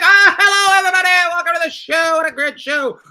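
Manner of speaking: high-pitched, excited welcome; show-opening announcer. Acted. Host greeting the audience as the show opens, excited announcer. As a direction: hail the whole audience at the top of your range.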